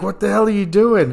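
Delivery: can't believe what he's doing; exasperated. Retold or imitated. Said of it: "I was like".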